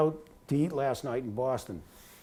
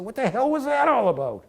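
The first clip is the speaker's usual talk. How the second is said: high, loud mock bewilderment; retold incredulous question, pitched way up; baffled outburst; 9 semitones higher, 5 dB louder than usual. Ex-player turning on the show-off rookie, loudly baffled.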